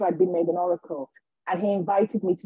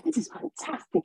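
high, softer thrilled voice; overjoyed vicar; 8 semitones higher, 6 dB quieter than usual. Retold or imitated. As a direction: imitate a vicar marvelling at the news. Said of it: "so happy for me".

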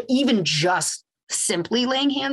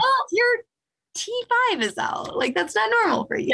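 breezy know-it-all doctor; 14 semitones higher than usual. Retold or imitated. Chiropractor voicing own casual reply.

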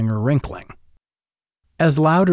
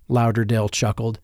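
plain reading voice

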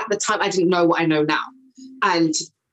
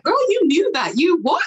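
higher, sassy self-congratulating; hyped self-talk; 9 semitones higher than usual. Retold or imitated. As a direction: cheer yourself on, playful. Retold self-talk after talk.

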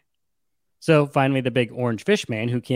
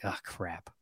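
very low, hushed resignation; dismayed aside; 6 semitones lower, 16 dB quieter than usual. Acted. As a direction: drop it right down under your breath. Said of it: "slowly nods".

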